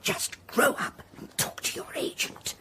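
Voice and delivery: hushed scolding. Real, not acted. Mother scolding quietly off phone.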